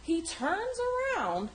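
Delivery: ordinary delivery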